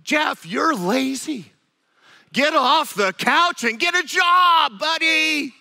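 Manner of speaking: slow, then loud, high scolding; wife yelling at her husband to get off the couch; angry nagging. Retold or imitated. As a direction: chew him out like a fed-up wife. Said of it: "and now suddenly it's".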